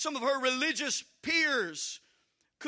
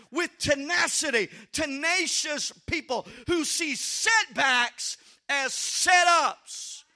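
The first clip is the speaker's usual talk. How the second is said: full-force preaching; 3 semitones higher, 6 dB louder than usual. Acted. Preacher rousing the congregation to full, shouted force. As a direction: drive the point home like a revival preacher.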